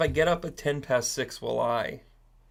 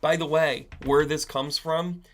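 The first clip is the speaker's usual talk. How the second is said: ordinary talk, nothing marked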